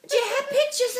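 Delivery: high mock English lady; English accent. English lady asking to see pictures, high imitated English accent. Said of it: "from England".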